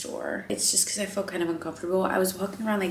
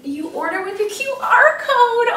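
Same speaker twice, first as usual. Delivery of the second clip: much higher and louder, thrilled discovery; excited; 9 dB louder than usual. Real, not acted. Vlogger thrilled she can order by QR code, excited squeal. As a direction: squeal it like the best news ever.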